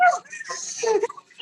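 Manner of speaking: high, theatrical fake crying; comic wail of collapse; mock breakdown sob. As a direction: burst into hammed-up sobs. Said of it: "you, like, break down on the floor".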